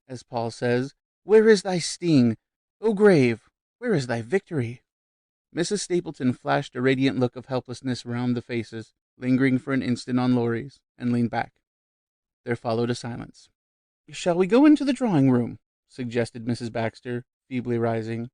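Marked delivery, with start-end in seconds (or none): 1.2-4.8 a society lady quoting Paul with fervour; grand scriptural quote. A narrator's reading. 14.1-15.6 high, loud hopeful suggestion; lightly voiced hostess ending dinner; feeble hostess. A narrator's reading.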